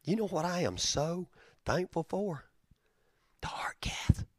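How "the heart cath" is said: soft whispered reveal; hushed grateful whisper. Real, not acted. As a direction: whisper it like a secret.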